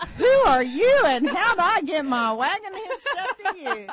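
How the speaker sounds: loud, high, hammed-up disbelief; mock indignant outburst. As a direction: belt it out like a comically appalled spouse. Acts out fed-up spouse waking up.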